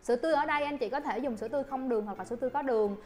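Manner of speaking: normal talking voice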